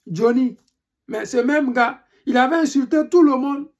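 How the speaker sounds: usual speaking voice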